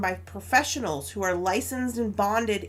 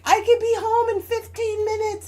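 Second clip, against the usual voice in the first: mock husband reply; 12 semitones higher, 6 dB louder than usual. Husband's reply in retold argument.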